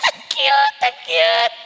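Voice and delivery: high-pitched gushing coo; squeaky, hammed-up adoration; delighted doting parent. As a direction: gush over your own child's singing. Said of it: "tuwang-tuwa ka".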